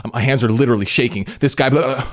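high-pitched, quick imitation of a rattled woman; mock frantic woman. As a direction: parody an overwrought video poster reporting shakes. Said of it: "doing it like that".

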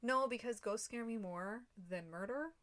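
ordinary talk

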